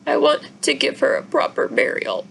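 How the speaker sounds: high, tearful plea; through tears. A narrator's reading. Izzy to her parents, a high tearful plea. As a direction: hold back tears as you ask. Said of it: "say through her tears".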